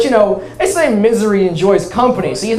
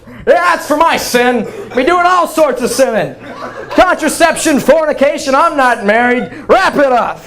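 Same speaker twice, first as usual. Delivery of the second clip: higher, proud sinner's announcement; defiant confession; 6 semitones higher than usual. Retold or imitated. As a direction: boast about sinning to the churchgoer. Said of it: "I was just like".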